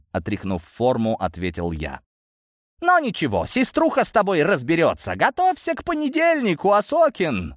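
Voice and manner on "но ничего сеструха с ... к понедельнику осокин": high, taunting threat; threatening. A narrator's reading. Thwarted Gromova to Osokin who escaped, threatening.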